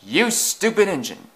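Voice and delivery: high, loud telling-off; angry scolding. A narrator's reading. Driver to Easton after the overshoot, angry scolding. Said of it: "scolded the driver".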